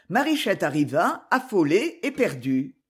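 plain reading voice

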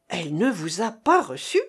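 astonished echoing question; taken aback, questioning; deep astonishment. A narrator's reading. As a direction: repeat it as a question in sheer amazement. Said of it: "avec un profond étonnement".

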